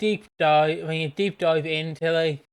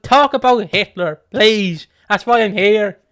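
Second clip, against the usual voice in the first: higher and louder, begging; mock pleading student; 5 semitones higher, 7 dB louder than usual. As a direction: plead with the teacher, loud. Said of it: "I'm like".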